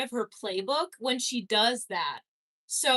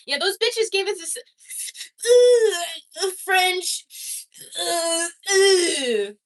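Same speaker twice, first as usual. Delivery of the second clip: higher, louder mock French muttering; hammed-up fake French sounds; mock French babble; 7 semitones higher, 6 dB louder, slower than usual. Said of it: "they just said a whole sentence with that".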